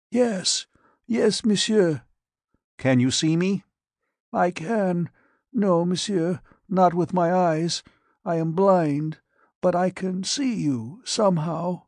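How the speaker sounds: put-on high, frail old man's voice; withered dying patient. Blind radiation patient answers the doctor, high and frail. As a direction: rasp it out like a man aged beyond his years.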